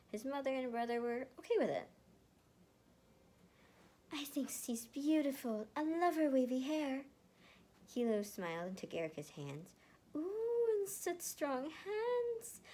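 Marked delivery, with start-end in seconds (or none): 4.1-7.1 high gushing mother. Acted. Mother praising Erica. 10.1-12.6 slow high ooh of admiration; higher, slow impressed coo. Acted. Kilo, holding Erica's hands, slow high admiring coo.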